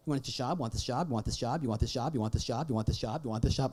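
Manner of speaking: rapid low monotone pestering, on a loop; nagging repetition. Acted. Parent imitating his small child's relentless asking, nagging.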